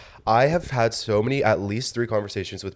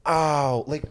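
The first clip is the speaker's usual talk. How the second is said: exaggerated impression cry, pitched up; Jennifer Coolidge; 5 semitones higher than usual. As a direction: throw out a hammy drawn-out cry. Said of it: "impression of Jennifer Coolidge".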